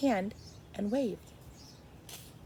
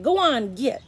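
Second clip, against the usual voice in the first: loud, higher shout; 5 semitones higher, 8 dB louder than usual. A narrator's reading. Boy shooing the crow off Edward, loud shout. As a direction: shoo the crow with a yell.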